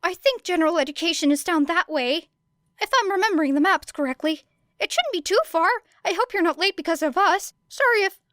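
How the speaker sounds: put-on high, fast, eager boy's helpful chatter; high, eager, rambling boy; brightened boy. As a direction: rush to help and apologise. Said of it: "Midoriya brightened".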